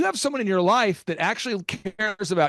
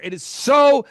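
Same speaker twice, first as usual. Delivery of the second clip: raised, higher, louder emphatic voice; carried away, voice raised; passionate yelling; 4 semitones higher, 5 dB louder than usual. Real, not acted. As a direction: punch it out like an impassioned coach. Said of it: "Sorry, I'm yelling".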